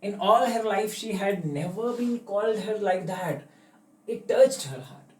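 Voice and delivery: slow, deliberate English read-aloud; slow story reading. A narrator's reading. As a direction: read each phrase slowly like a storybook. Reading story text aloud slowly.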